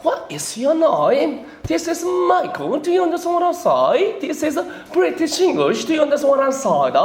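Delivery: high, very slow mock British English; hammed-up posh British speaker; British accent imitation. Lecturer imitates British people meeting him, high slow mock British accent. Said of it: "영국 사람만 나면 이렇게 합니다".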